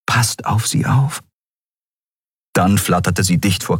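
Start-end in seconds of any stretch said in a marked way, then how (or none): 0.1-1.2 slow, higher-pitched plea; pleading. A narrator's reading. Nelen begging spirits to watch over friends, pleading. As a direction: beg slowly for their protection. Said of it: "flehte Nelen".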